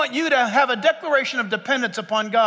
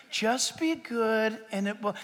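soft, slow tempting whisper, much quieter; mock whisper; 4 semitones lower, 8 dB quieter, slower than usual. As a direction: murmur it like a sly temptation. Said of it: "that was whispered to you".

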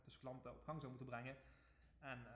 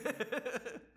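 loud burst of laughter; 9 semitones higher, 10 dB louder than usual. Real, not acted. Laughs at video tapes question.

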